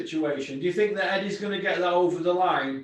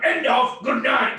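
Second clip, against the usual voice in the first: usual speaking voice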